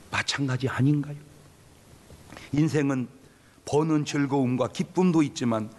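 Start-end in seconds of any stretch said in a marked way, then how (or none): none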